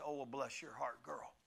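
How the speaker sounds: a hint of a churchy old lady, softly; Baptist lady. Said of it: "what old Baptist lady would say".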